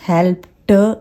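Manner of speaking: हेल्प्ड as "help-tuh"; two-beat हेल्प्ड with full ta; full T sound. Shown as a demonstration. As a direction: wrongly add a vowel after t in हेल्प्ड. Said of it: "नहीं है".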